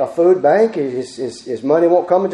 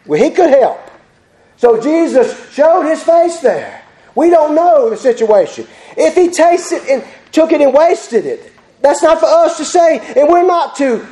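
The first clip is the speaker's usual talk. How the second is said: high, raised preacher's voice; full-force preaching; 8 semitones higher than usual. Acted. Preacher urging church to help the needy, full-force preaching. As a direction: preach it out, pitched high and urgent.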